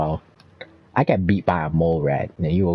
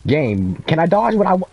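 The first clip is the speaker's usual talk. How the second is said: ordinary talk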